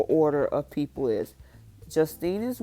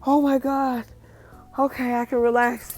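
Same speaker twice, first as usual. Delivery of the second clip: relieved burst; 7 semitones higher, 4 dB louder than usual. Retold or imitated. Caleb relieved she loves him.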